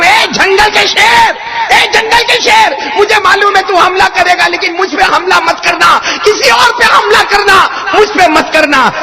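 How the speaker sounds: a companion shouting his plea at the lion; loud plea to a lion. Acted. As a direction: beg the lion loudly to spare you. Pleading loudly with the lion.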